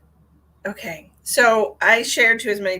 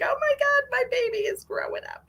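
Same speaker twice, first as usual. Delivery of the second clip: high-pitched mock parental wonder; imitated gushing, high voice at normal volume; imitated amazed parent. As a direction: play a parent marveling, way up high.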